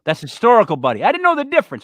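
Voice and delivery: loud excited praise. Real, not acted. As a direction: cheer the buddy on. Excited about correct word.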